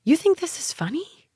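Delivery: high, sharp snapped question; angry snap. A narrator's reading. Rhonda snapping at smug Benny over the name tag. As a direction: snap at him, furious. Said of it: "I snapped".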